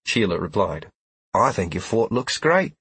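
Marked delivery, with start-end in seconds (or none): 1.3-2.7 gentle, encouraging reply; reassuring. Acted. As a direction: comfort him kindly. Sheila reassures after Trell's insult.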